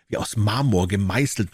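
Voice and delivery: plain reading voice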